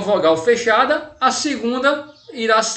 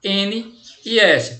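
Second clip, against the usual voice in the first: slow spelled letters; much slower than usual. Shown as a demonstration. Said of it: "terminar com as consoantes".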